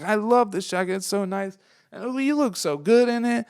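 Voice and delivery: high, delighted imitation of the lady; gushing lady. Stranger in the crowd praising the jacket, high and gushing. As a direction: rave about it in a high, thrilled voice.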